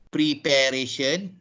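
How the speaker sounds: "preparation" as pree-PAIR-ation; wrong pronunciation. Retold or imitated. Teacher imitating a common Malaysian error, pree-PAIR-ation. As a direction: keep all of prepare inside "preparation". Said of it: "many Malaysians wrongly pronounce".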